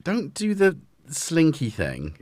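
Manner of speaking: high, slow pet-scolding voice; telling off the cat. Real, not acted. Owner telling the cat off, high and slow. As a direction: wag a finger at the cat in a singsong.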